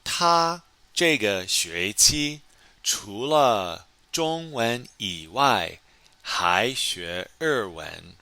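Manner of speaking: slow, careful model reading; slow model sentence. Shown as a demonstration. Teacher reading the answer to students, slow and careful. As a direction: spell each word out for learners. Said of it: "好，第一，".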